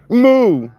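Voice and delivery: high, loud drawn-out moo at the cows; mock cow moo. Calling out to the cows with a high, loud moo. Mooing back at the herd.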